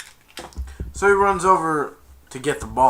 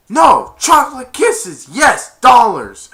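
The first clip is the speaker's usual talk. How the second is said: high, very loud mock exasperation; sarcastic outburst; 10 semitones higher, 14 dB louder, slower than usual. Santa answering about three hundred dollars, sarcastic shout. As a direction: punch each answer loudly. Sarcastic retort in retold haggling.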